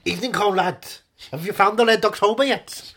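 film-style Russian accent, projected loudly. Shown as a demonstration. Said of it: "I could do a Russian accent".

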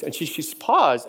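ordinary talk

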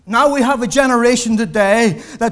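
pulpit-pounding sermon climax; full-force preaching. Real, not acted. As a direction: preach it out with rising fire to the congregation.

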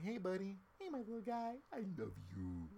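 put-on high-pitched baby talk to a little one; cutesy Daddy voice. Shown as a demonstration. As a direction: coo lovingly at your small child. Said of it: "it's a lot more cutesy".

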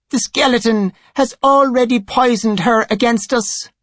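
high-pitched, bitter complaint. A narrator's reading. High Priest blaming the skeleton for turning her, bitter. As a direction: say it with bitterness towards a rival. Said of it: "Tenebrae said bitterly".

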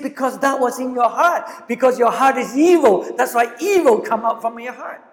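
high, loud, forceful sermon delivery; fervent preaching. Acted. Preacher voicing Jesus rebuking evil hearts with fervor. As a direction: drive home the rebuke with force.

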